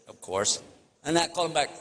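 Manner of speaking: ordinary talk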